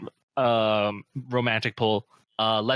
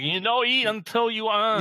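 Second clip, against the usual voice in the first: mock restaurant staff; 8 semitones higher than usual. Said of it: "They're like".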